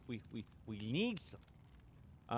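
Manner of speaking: slow, soft, desperate "We, we, we need them"; needy. Pretend. Streamer begging viewers for watch hours, slow and soft. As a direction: drag out "need" like a craving.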